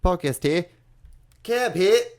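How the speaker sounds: put-on high hammy Jersey accent. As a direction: ham up a Jersey shore accent. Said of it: "belittle the people of Australia with that accent".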